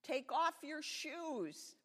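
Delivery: slow, loud, high command; thundering voice. A narrator's reading. God orders Moses at the bush, slow thundering command. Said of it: "the voice thundered".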